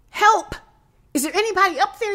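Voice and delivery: high, loud, hurried cry for help; shouting for help. A narrator's reading. Fallen climber calling up the cliff for help, shouting. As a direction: shout up urgently, pitch way up. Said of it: "he shouted".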